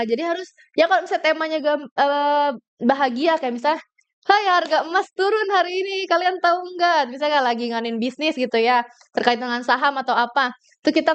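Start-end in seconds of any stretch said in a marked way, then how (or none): 4.3-7.1 bright, higher pitched announcement; cheerful news delivery. Shown as a demonstration. Presenter demoing a cheerful market update to listeners. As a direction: beam as you share the good news. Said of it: "harus sumringah gitu loh".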